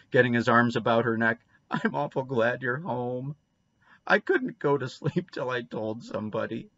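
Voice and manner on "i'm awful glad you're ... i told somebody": put-on mildly tearful child voice; sobbed. A narrator's reading. Boy clinging to Anne's neck, mildly tearful. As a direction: pour it out with a touch of a sob. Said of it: "sobbed Davy".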